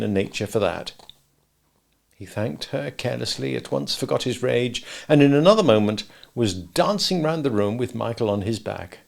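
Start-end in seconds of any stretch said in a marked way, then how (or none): none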